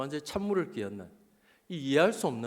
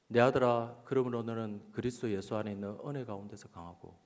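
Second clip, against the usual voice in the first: scripture reading; 5 semitones lower than usual. Retold or imitated. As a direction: read it as God's word to a son, lower. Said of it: "제가 다시 읽었습니다". (낮은 음성으로 성경 봉독)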